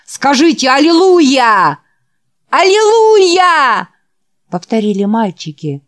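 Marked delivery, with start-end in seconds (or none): 0.1-3.9 high, loud rousing preacher call; preacher's shout. Acted. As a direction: whip the crowd into a shout. Preacher calls boys to shout. (громкий призыв проповедника)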